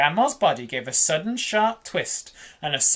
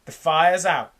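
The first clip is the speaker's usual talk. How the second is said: ordinary delivery, nothing marked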